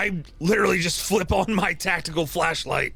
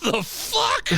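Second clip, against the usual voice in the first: high squeaky disbelief; shocked. Real, not acted. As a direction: yelp it out in shock. Player shocked by vampire.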